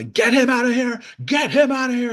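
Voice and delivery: high-pitched, strained mock yell; mock screaming. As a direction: act out an outraged senator's scream. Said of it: "they start screaming at each other".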